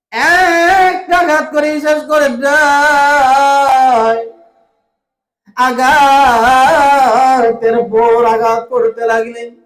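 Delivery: normal talking voice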